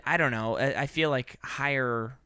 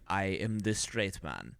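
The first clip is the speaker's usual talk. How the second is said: slow, lower mock Russian accent; 6 semitones lower, 6 dB quieter, slower than usual. Imitating the Russian character in a slow Russian accent. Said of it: "and then he's like".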